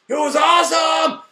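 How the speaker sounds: loud, high burst of fan joy; excited shout. Real, not acted. Reviewer gushing over the battle scene, loud excited shout.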